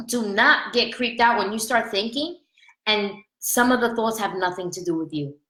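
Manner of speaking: normal talking voice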